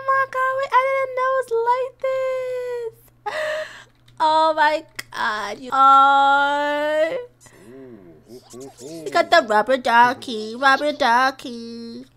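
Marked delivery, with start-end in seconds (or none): 0.1-7.2 high, slow cry of delight; squealing delight. Real, not acted. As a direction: gush over the cute thing. Delighted by the baby bath.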